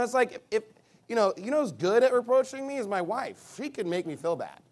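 normal talking voice

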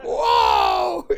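shouted whoa, high; amazed. Retold or imitated. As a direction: gasp in amazement. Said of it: "they're like".

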